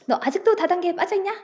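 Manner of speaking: acted shout. Hyori yelling at her friend about network marketing, exasperated. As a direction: shout it out in disbelief. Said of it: "바로 고함을 지르죠".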